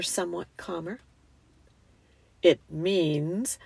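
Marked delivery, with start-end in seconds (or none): 2.4-3.6 lion character. A narrator's reading. Aslan begins solemn explanation.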